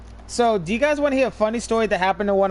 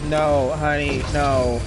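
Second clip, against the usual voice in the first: condescending; 7 semitones lower, slower than usual. Retold or imitated. Narrator talks down to friend.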